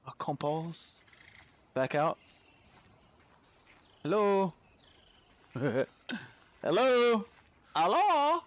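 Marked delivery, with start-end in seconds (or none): 4.0-8.4 rising high sing-song calls; calling out. Real, not acted. Player calling into empty cave, high calls. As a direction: call hello into the dark.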